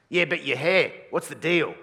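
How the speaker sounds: loud, baffled demand; older guy. Acted. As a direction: demand an explanation like an indignant older bloke. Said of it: "they'll say something like this".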